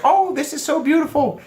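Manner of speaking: high, gushing imitation of a woman; very expressive woman. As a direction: coo it way up in pitch. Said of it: "act very expressive".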